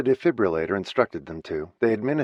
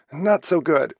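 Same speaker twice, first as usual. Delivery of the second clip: high and louder, fighting back a sob; holding back sobs; 8 semitones higher, 5 dB louder, slower than usual. Acted. Kate breaking bad news to sister, holding back sobs. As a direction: barely keep from crying. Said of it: "Kate tried not to sob".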